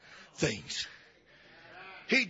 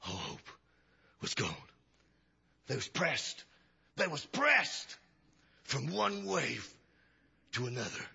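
hushed storytelling; 8 semitones lower, 8 dB quieter than usual. Acted. Hushed dramatic storm retelling.

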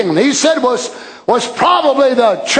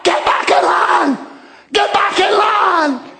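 retold scream; 6 semitones higher than usual. Preacher retelling the prophet's cry to the straying church, mock scream. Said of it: "he screamed out".

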